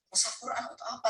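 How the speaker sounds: soft, inward voice, barely said; as if asking only in his own head; a private thought said softly under the breath; inner thought. Retold or imitated. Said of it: "kan dalam hati saya".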